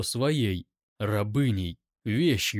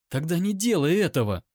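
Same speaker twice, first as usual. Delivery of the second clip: higher, fast, insistent appeal; urgent plea; 6 semitones higher, 4 dB louder, faster than usual. A narrator's reading. Captive begs him not to mark her, urgent. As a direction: rush out the plea.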